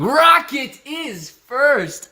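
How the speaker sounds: high, loud and very slow announcement; slow, hyped-up call of who came first; drawn-out hype. Acted. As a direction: stretch it out like a ring announcer. First take drawn out, louder.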